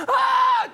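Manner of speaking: high, loud mock scream; imitated shocked scream. Man mimicking a woman who saw her dead brother's double, screaming. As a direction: shriek as if seeing a ghost.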